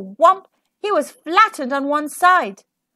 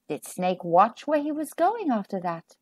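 plain reading voice

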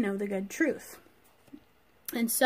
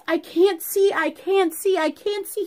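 higher, louder panicked cry, repeated; 6 semitones higher, 7 dB louder than usual. Acted. Blinded Elymas crying out in panic. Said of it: "he goes".

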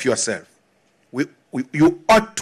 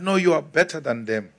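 patronizing; 3 semitones lower, 6 dB quieter, faster than usual. Retold or imitated. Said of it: "patronize you".

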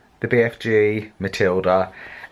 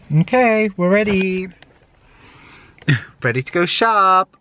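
excited; 9 semitones higher, 5 dB louder than usual. Real, not acted. Excited before shopping.

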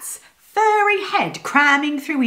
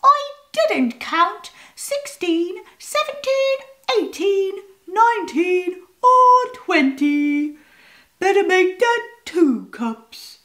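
cat voice; 9 semitones higher than usual. A narrator's reading.

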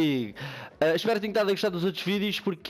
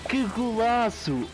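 explosive goal commentary; 8 semitones higher, much slower than usual. Real, not acted. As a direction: stretch every word in disbelief. Celebrating his own goal.

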